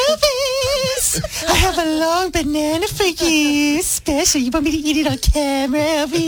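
whiny, drawn-out fawning; hammed-up teacher's pet, stretching the name; imitating Scotty. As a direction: suck up to the boss in a slow, cartoonish whine. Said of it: "how does he sound".

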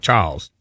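Charles said British-style in one syllable; British one-syllable pronunciation. Shown as a demonstration. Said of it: "if you're British".

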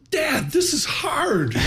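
high, drawn-out imitation of a kid's complaint; complaining daughter. Daughter phones dad about microeconomics class, mock complaining.

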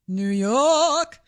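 drawn-out New York accent on "York". Shown as a demonstration. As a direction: say "New York" like a native, stretching York. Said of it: "she toned that one down".

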